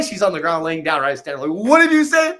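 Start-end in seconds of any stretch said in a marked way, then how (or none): 1.7-2.4 high, loud stern demand; repeating the challenge to a member on the floor. Retold or imitated. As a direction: tower over her and demand it. Standing over her, repeating challenge.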